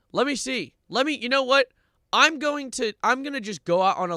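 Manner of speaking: fired up to check; excited. Real, not acted. Streamer rushing to check a website, high excited.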